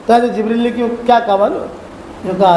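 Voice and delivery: normal talking voice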